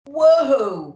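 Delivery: loud, high startled whoa; startled cry. A narrator's reading. Santa jolted as the sleigh lurches, crying out. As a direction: pull up sharply in surprise. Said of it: "cried Santa".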